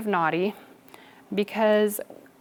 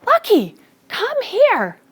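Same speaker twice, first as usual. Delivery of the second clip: high, loud call across the yard; calling the dog; 13 semitones higher, 7 dB louder, slower than usual. Retold or imitated. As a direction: shout for the runaway puppy, much higher. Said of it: "I would say".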